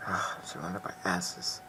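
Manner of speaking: whispered under breath; whispered aside. Acted. Muttered aside, unvoiced.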